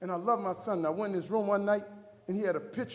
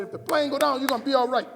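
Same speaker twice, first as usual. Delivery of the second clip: higher, much louder retold assurance; loud insistence; 5 semitones higher, 10 dB louder than usual. Husband overriding his wife's protest, loud and insistent. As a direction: push the reassurance out loudly.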